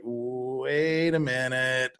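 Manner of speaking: dawning suspicion, stretched out; slow realization. Retold or imitated. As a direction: viewer slowly catching on. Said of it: "And then suddenly you're like".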